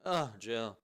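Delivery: ordinary talk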